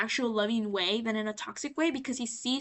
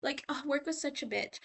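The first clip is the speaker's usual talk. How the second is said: soft, airy mock gossip; lightly imitated catty small talk; 3 semitones higher, 10 dB quieter than usual. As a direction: act out chatting with the guys. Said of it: "he's, like, gossiping".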